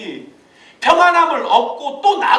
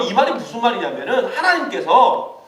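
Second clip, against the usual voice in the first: ordinary delivery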